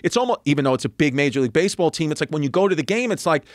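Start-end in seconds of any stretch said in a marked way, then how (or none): none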